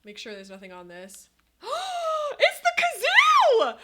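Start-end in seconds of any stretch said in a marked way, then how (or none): 1.6-2.3 sharp high gasp of joy; high delighted gasp. Real, not acted. Sees the kazoo in the box. 2.4-3.8 very high, loud, slow squeal of joy; ecstatic shriek. Real, not acted. Unboxing the wanted kazoo.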